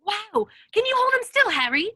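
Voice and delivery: eager shout over the scene; thrilled fan. Acted. Colin fascinated, with a camera.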